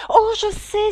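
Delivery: put-on high, loud, eager cry of a girl; Alice jumping in excitedly; eager exclamation. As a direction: blurt it out as young Alice. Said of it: "s'écria Alice".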